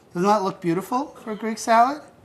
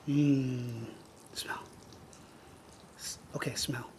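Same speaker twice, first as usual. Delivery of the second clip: hushed smell; 5 semitones lower, 17 dB quieter than usual. Real, not acted. Inviting the child to smell.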